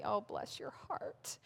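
sarcastic whisper under the breath; hushed, mock-sweet jab; whispered aside. Whispering sarcastically to the women about the perfect wife.

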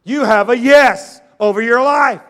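ordinary talk, nothing marked

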